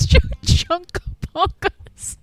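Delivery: can't get the word out for laughing; laughing fit. Real, not acted. Game master cracking up at the made-up creature name, laughing. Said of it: "why that's so funny".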